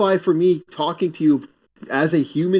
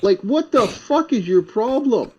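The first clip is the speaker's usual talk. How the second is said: higher, slow, punched-out challenge; exasperated; 7 semitones higher, slower than usual. Retold or imitated. Apartment hunter retells confronting angry son, exasperated. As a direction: demand an explanation in mild disbelief.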